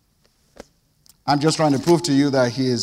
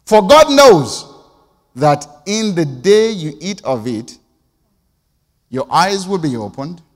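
emphatic proclamation; 7 dB louder than usual. Retold or imitated. Serpent's pitch, emphatic.

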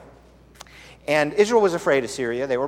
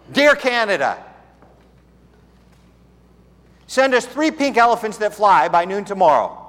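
mock letter; 7 semitones higher, 5 dB louder than usual. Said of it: "So we send him a note".

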